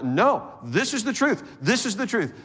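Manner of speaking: dogmatic insistence. Acted. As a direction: refuse to give an inch. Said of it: "they're not giving any ground".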